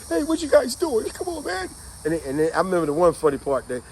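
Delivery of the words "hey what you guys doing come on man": frightened plea. Retold or imitated. Turned over by Klansmen.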